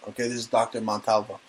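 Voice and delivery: ordinary delivery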